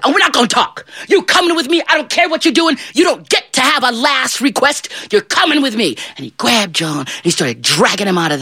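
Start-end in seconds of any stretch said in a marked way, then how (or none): 0.0-6.0 high, forceful scolding; angry tirade. Acted. Devil comes to drag John away, angry tirade. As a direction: overrule him in a high, heated voice.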